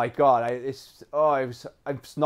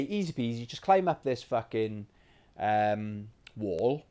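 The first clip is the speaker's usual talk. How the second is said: ordinary talk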